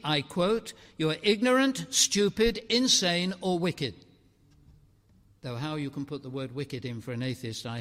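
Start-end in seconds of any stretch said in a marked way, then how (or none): 1.0-4.1 higher, louder mock accusation; quoted insult. Preacher quotes Dawkins' insults with loud mock scorn. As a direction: fling the insults out. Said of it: "Richard Dawkins says".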